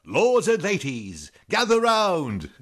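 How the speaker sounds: high, loud, drawn-out hawker's cry; fair crier's call. Acted. Throwing in a Renaissance fair greeting, hammed-up loud crier's call. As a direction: call the crowd in, stretching every word. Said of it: "you gotta throw in the".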